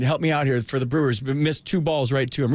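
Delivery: normal talking voice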